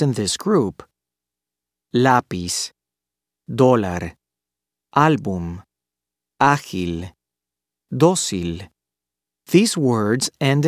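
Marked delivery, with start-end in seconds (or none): 1.9-8.7 LÁ-piz, DÓ-lar, ÁL-bum, Á-gil, DÓ-cil, endings left light; next-to-last stress. Shown as a demonstration. Teacher models word group for learners, each stressed on the next to last syllable. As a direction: stress the first syllable of "Lápiz", "Dólar", "Álbum", "Ágil", "Dócil". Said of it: "on the next to last syllable".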